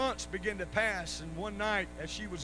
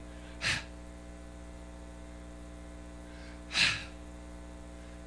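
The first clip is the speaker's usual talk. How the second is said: imitated annoyed huffing; long mock huffs of breath; exasperated huff. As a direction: huff like a fed-up wife. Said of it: "she's just huffing".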